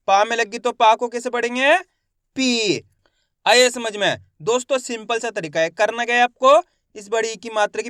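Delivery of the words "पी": पी with a long held ee; stretched pronunciation. Shown as a demonstration. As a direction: stretch the vowel of पी, falling at the end. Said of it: "मैं इसका खींच के उच्चारण कर रहा हूँ".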